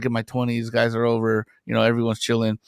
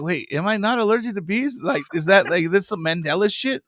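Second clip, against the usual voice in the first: higher, puzzled questioning; mild imitated confusion; confused self-doubt; 7 semitones higher than usual. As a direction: talk yourself into doubt. Retold confusion.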